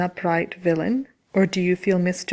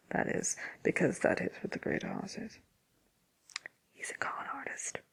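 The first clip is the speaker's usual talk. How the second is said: hushed aside; 4 semitones higher, 10 dB quieter than usual. Acted. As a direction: voice sinking to a near-whisper. Muttered aside disparaging the Great Oz.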